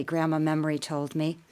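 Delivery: ordinary delivery, nothing marked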